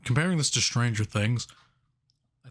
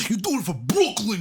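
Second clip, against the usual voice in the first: high-pitched mock Brooklyn accent; forced Brooklyn accent; 10 semitones higher, 3 dB louder than usual. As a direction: ham up a fake Brooklyn accent. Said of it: "it was such a forced accent".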